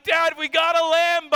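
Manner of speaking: put-on a boy flipped out over the car; excited kid. Son telling dad about the car, mock thrilled. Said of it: "He's like".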